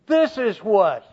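"This is what" pitched high and loud; emphatic phrase. Acted. Preacher reading Paul's emphatic phrase, stressed. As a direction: hit "This is what" hard, then fall. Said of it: "that's, that's an emphatic phrase".

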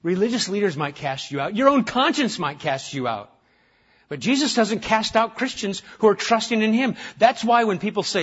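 ordinary talk

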